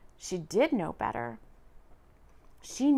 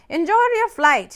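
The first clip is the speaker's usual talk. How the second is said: cheerful driver; 8 semitones higher, 10 dB louder than usual. A narrator's reading.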